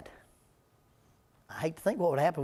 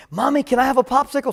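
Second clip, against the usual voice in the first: put-on higher, louder little-kid plea; mock toddler asking; 7 semitones higher, 7 dB louder than usual. Small child begs mommy for a popsicle, hammed-up toddler voice. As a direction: ask like an eager small child. Toddler asks mom for treat.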